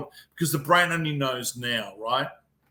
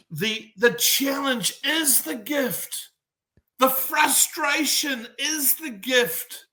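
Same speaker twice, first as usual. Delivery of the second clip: slow, high, weighty delivery; emphatic maxim; 10 semitones higher, slower than usual. Acted. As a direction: lay it down like a creed.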